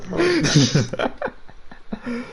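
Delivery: rising high-pitched giggle. Real, not acted. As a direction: break into a squeaky laugh. Laughing at friend's reaction.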